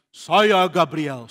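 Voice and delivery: slow, grand angel voice; suara Gabriel. Acted. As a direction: announce yourself like a mighty angel. Malaikat Gabriel memperkenalkan diri. (malaikat agung, lambat dan megah)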